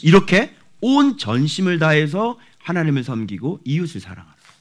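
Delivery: usual speaking voice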